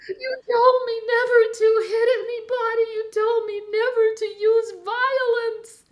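put-on high, loud, tearful child pleading; imitating a sobbing four-year-old; crying little boy. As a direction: voice the small son wailing through his tears. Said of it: "he started crying".

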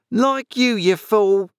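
high-pitched indignant jibe; scolding wife. Acted. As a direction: snap at your husband in a high voice. Scolding wife berates her husband.

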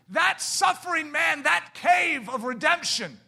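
slow, high, shouted preacher climax; preaching at full force. Acted. Preacher shouting to the church that mercy triumphs over judgment. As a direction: proclaim mercy to the congregation at full volume.

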